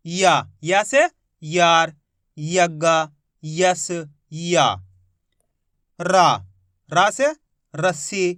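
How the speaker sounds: ordinary talk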